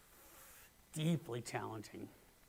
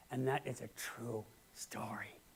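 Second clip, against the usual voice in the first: whisper; 4 semitones lower, 5 dB quieter than usual. Real, not acted. Preacher closing the story.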